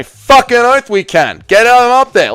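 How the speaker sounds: high, loud burst of enthusiasm; excited agreement. Real, not acted. Streamer eagerly agreeing to promote a friend's channel, excited. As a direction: shout your yes like a hype man.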